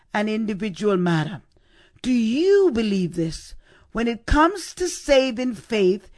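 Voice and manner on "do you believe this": "you" stretched and raised; stress on YOU. Shown as a demonstration. Preacher makes salvation a personal matter, stress on YOU. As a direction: press the question home on "you". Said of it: "Perhaps Jesus emphasized the "you".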